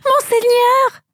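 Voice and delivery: high, overjoyed exclamation; joyful cry. A narrator's reading. Marie rushing to Jesus, joyful high cry. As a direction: cry his name out in sudden joy. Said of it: "s'écria".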